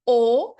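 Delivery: "O" said with well rounded lips, loud. Shown as a demonstration. Teacher showing the lip shape, a loud rounded O. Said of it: "como se você fosse falar".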